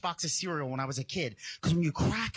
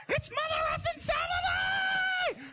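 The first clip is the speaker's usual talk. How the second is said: high, slow, drawn-out battle cry of joy; hammed-up kid screaming for Saturday; ecstatic yell; slower than usual. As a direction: leap out of bed yelling. Bursting awake on Saturday morning.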